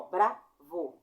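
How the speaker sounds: "bravo" drawn out very slowly; slow demo. Shown as a demonstration. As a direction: stretch "bravo" syllable by syllable. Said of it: "dois golpes de ar". ("bravo" bem devagar, sílaba por sílaba)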